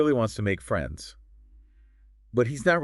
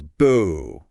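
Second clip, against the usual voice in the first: a retold Boo, louder and punchy; ghostly yell; 6 dB louder than usual. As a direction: jump out and shout it to startle. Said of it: "jumps out from behind a suit of armor and yells".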